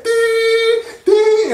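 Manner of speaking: high sustained electronic dings; two high, ringing notes; imitated toy laser. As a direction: mimic a toy's laser beeps. Imitates the toy's laser sound.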